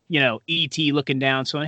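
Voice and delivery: ordinary delivery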